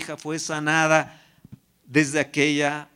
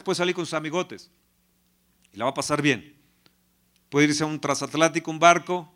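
ordinary talk

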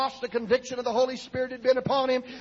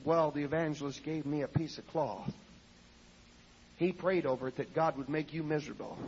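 hushed confession; 5 semitones lower, 5 dB quieter than usual. Retold or imitated. Wife's sheepish confession retold.

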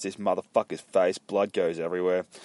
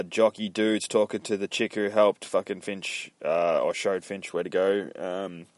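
ordinary delivery, nothing marked

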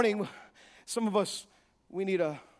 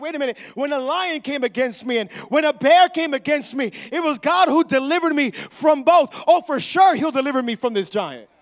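loud, raised preacher's voice; full-force preaching; 3 semitones higher, 10 dB louder than usual. Real, not acted. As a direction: fire up the congregation.

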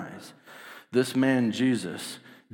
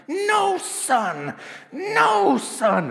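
put-on high, loud little child shouting; toddler voice; 5 dB louder, much slower than usual. Infant daughter rebuking the sun, high loud squeal. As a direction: squeal it like a baby.

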